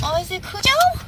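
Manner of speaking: high cooing greeting; high sing-song voice for the pet; talking to a dog. Real, not acted.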